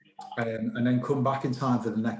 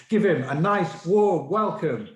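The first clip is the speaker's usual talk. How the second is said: lively, louder and higher; upbeat host rallying the audience; host introduction; 4 semitones higher, 5 dB louder than usual. Real, not acted. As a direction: whip up the applause for the next act. Host calls up next act.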